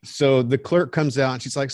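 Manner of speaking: ordinary delivery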